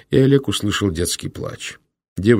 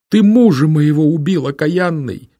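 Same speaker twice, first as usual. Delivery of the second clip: furious accusation; 10 semitones higher, 7 dB louder than usual. Acted. Widow accuses brother of murder.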